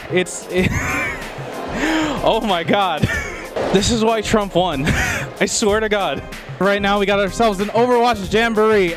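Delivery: ordinary talk, nothing marked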